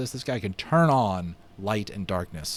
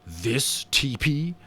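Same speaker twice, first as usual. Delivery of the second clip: scornful; 3 semitones higher, much slower than usual. A narrator's reading. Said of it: "with the utmost scorn".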